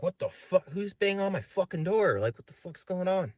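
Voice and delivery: high-pitched mock startled outburst; retold alarmed outburst. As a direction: react in alarm to banging at the door, pitched high. Startled reaction to banging retold.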